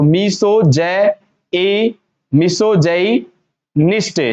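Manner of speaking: मिसो जे ए, मिसो जई निष्ठे slowly, piece by piece; syllable by syllable. Shown as a demonstration. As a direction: build it up "mi-so, jay-ee, nisht" with pauses. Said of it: "ऐसे बोल सकते हैं अपन इसको". (टुकड़ों में रुक-रुक कर उच्चारण)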